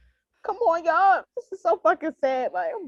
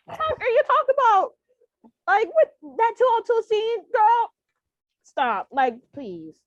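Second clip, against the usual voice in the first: much higher, louder disbelief, slowing down; incredulous outburst; 7 semitones higher, 4 dB louder, slower than usual. Retold or imitated. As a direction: retell it as a shocked rave. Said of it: "I'm like".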